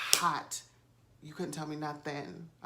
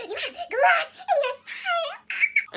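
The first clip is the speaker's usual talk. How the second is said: much higher, much louder, slow, laughing mock panic; mocking Anthony; 12 dB louder, slower than usual. Boyfriend panicking over a tiny glass shard, hammed-up high shriek. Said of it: "he's literally like".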